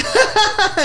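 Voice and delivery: very high put-on laughter; fake polite laugh. Worker humouring the jingling customer, fake laughing. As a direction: force out a high mock laugh.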